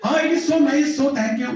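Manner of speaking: high, pleased thanks; pleased king. Retold or imitated. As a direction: thank him with a high happy lift. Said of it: "Vrishabhanu is like".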